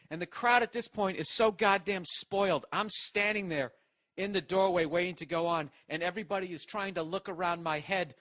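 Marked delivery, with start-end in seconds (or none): none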